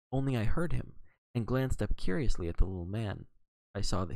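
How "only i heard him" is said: ordinary delivery, nothing marked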